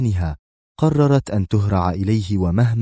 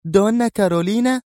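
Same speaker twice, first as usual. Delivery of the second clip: much higher astonished question; great surprise; 12 semitones higher than usual. A narrator's reading. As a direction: gasp the name in disbelief. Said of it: "هتفت منى بدهشة بالغة".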